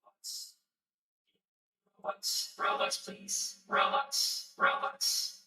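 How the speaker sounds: very quiet, voiceless pleading whisper; whisper voice effect. Shown as a demonstration. Streamer tests whisper effect begging for Robux, hushed whisper. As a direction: whisper it through a voice changer, barely audible. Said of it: "It's called Whisper".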